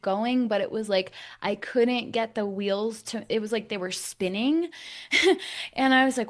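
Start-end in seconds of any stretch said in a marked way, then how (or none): 4.8-5.7 airy laugh. Real, not acted. Laughing at own hardship.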